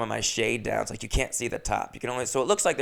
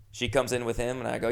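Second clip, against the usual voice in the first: normal talking voice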